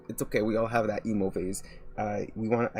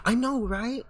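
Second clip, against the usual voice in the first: high-pitched, tumbling fangirl gush; excited agreement; 9 semitones higher than usual. Real, not acted. Streamer agrees with a viewer praising the artist, excited gushing. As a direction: agree eagerly, stumbling over restarts.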